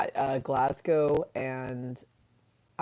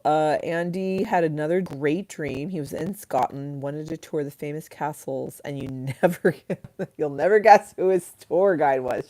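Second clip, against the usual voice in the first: usual speaking voice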